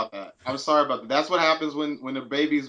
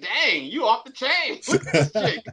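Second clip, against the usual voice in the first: high, laughing sassy praise; sassy admiring voice; 9 semitones higher than usual. Retold or imitated. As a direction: gush over her with playful sass, high. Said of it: "I was like".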